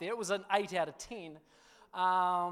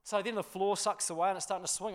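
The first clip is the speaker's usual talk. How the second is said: normal talking voice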